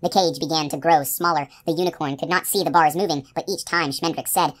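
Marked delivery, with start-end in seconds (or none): none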